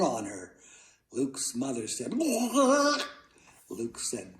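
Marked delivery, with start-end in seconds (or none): 2.1-3.1 high, drawn-out wobbly wail; crushed cry. A narrator's reading. Moose lands on mother, high crushed cry. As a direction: let out a squashed high yelp.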